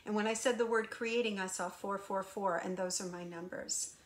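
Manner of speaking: ordinary talk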